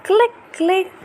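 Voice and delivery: high, clicky imitation of a dolphin; dolphin clicks. Mother imitating dolphin clicks for baby, high mimicry.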